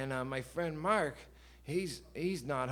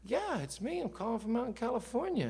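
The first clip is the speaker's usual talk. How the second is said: higher, lightly voiced humble caller; 9 semitones higher than usual. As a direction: play the star modestly confirming it's really him. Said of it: "And he says".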